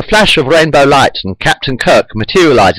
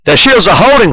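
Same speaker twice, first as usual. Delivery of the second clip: ordinary delivery, nothing marked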